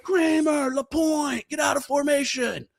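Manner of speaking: high barked shout of names; drill instructor shout. Retold or imitated. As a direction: call them out like a drill instructor. Drill instructor calling recruits.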